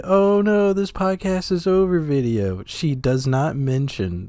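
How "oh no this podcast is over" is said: high, quick mock lament; mock dismay. Co-host mocks streamer's farewell video, high mock dismay. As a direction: rattle it off as pretend distress, pitched way up.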